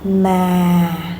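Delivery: "Ma" drawn out, gently sinking; falling tone. Shown as a demonstration. Teacher demonstrating the third tone, gently falling. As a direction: let "Ma" ease downward like a tired sigh. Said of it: "your voice falls gently".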